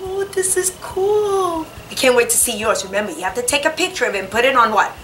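ordinary talk

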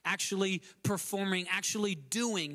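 usual speaking voice

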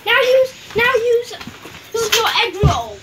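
loud, high excited yelling; excited shout. Real, not acted. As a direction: shout the command at your teammate. Shouting game instructions.

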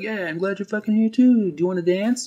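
high, whiny weepy voice; hammed-up tearful reunion; mock tearful old man. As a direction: keep blubbering sweetly as you ask. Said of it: "And he's like".

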